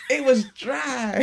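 slow, drawn-out West Indian accent. Real, not acted. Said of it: "that's my West Indian accent coming out there".

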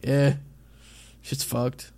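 ordinary talk

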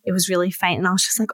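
normal talking voice

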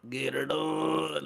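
slow, drawn-out Southern "Get her done."; mock Southern drawl. Shown as a demonstration. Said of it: "you have to go like that".

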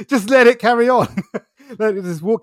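high, loud words through a laugh; real laughing. Real, not acted. Coach telling listeners not to attach to anger, laughing. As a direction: urge it while laughing. Laughing while urging letting go.

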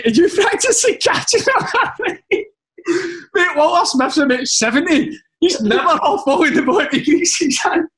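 high, laughing through the words; barely holding in the giggles. Real, not acted. Retelling his prank text to mates, laughing.

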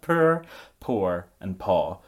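Scottish "poor", "poor", "paw": oo-R, oh-R, open aw; three different pronunciations. Shown as a demonstration. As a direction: keep "poor", "poor" and "paw" distinct, R rolled into the first two. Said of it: "Three different pronunciations".

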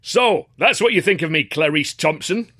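stern father's bellow, pitched high; bellowed impersonation of father. A narrator's reading. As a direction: boom it out as the angry old man. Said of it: "I bellowed at the top of my voice".

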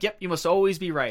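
high, loud retort to needle him; mock agreement with an angry edge; angry, inciting. Angry wife inciting her husband mid-argument, needling. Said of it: "'cause she's angry too".

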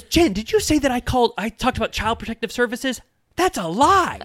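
hammed-up emphatic protest; emphatic denial. Shannon confronting Jen about the comment, loud emphatic. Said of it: "She says it so emphatically".

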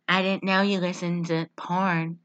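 low, soft deadpan quote; unexcited mock line reading; monotone. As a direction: imitate her lifeless delivery. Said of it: "saying in this monotone voice".

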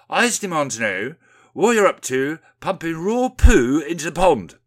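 loud, high-pitched demand; indignant declaration. A narrator's reading. Windy confronting the officials over the pond, loudly indignant. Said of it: "declares Windy".

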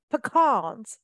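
drawn-out "pecans" as puh-KAHNS; la-di-da pronunciation. Retold or imitated. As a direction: stretch "pecans" slowly, second syllable KAHN. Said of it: "it was like this very la-di-da accent".

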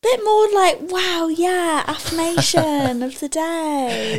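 high, bright hammed-up excitement; excited. Shown as a demonstration. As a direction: gush it like a hyped host. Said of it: "a little bit more excitement would be".

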